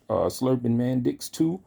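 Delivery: ordinary talk, nothing marked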